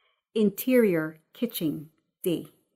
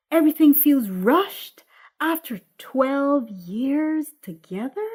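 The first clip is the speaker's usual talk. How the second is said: incredulous echo, louder; Patrice; 6 semitones higher, 4 dB louder than usual. Acted. Patrice to Jerome, incredulous echo of his excuse. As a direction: throw his words back at him in disbelief.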